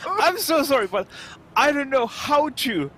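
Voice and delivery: high, loud mock apology; exaggerated apologetic protest, pitched well up; acted helpless apology. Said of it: "and I'm always like".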